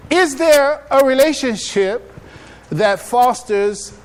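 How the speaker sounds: high, loud and slow, each word leaned on; emphatic question. Real, not acted. Preacher pressing the crowd with a slow, loud question. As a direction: drive the question home to the audience.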